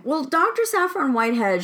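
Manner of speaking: ordinary delivery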